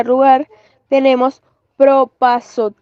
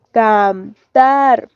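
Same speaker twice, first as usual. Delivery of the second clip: "Cantar" split slowly, TAR stressed; "Cantar" syllable by syllable, weight at the end; stress on last syllable. Shown as a demonstration. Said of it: "el acento ocupa la última sílaba".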